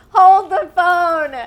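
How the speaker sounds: loud, high, drawn-out exclamation; mock outrage. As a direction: call a sudden halt, slowly and at full volume. Acting out her shocked reaction.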